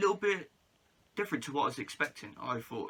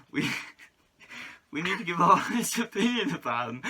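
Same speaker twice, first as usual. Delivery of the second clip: giggling through the words; loud and breaking into laughter; laughing; 6 semitones higher, 10 dB louder than usual. Real, not acted. Guest giggling through his intro.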